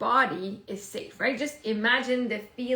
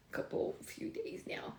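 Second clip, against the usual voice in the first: whisper; 8 semitones higher, 12 dB quieter, slower than usual. Real, not acted. Tired aside, whispered.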